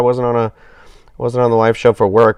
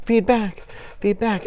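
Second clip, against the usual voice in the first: playful high singsong; 9 semitones higher, 6 dB quieter than usual. Pretend. Mocking audio feedback squeal.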